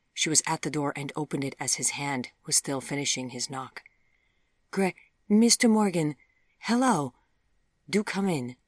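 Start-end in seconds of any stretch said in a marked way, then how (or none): 4.7-8.6 higher, louder, self-correcting welcome; flustered greeting. A narrator's reading. Daughter greeting her former beau at the door, flustered. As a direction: catch yourself, then welcome him eagerly.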